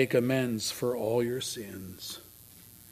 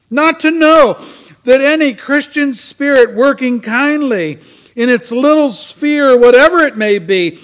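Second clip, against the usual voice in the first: loud, high, drawn-out theatrical lament; crying phantom; 11 semitones higher, 16 dB louder than usual. Acted. Dickens' ghost laments a life too short for amends, anguished loud cry. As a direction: cry out each phrase slowly, building in force. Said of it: "cried the phantom".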